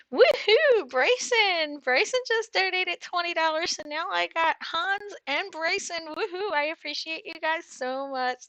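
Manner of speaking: excited. Real, not acted. Thanking donors on stream.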